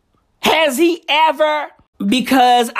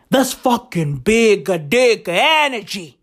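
ordinary talk, nothing marked

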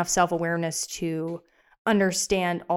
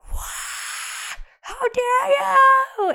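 crying shout; 4 dB louder, much slower than usual. Retold or imitated. Retold overreaction to small thing.

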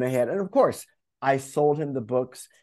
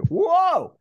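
high, loud startled whoa; a big, amazed whoa; stunned whoa; 5 dB louder than usual. Retold or imitated. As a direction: let the surprise jump way up. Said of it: "I was like".

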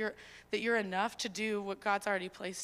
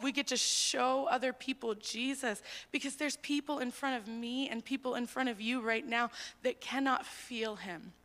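usual speaking voice

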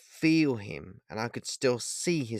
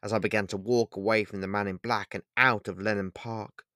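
usual speaking voice

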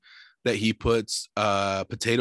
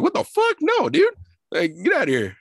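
squeaky mock outrage; high-pitched indignant brush-off; high outraged dude; 5 dB louder than usual.

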